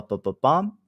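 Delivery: ordinary talk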